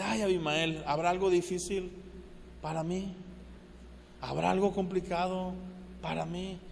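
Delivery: calm unbothered God. Retold or imitated. Preacher voices God calm, unbothered.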